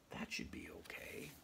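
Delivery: relieved hushed whisper. Real, not acted. Relieved the next animal is small, whispered.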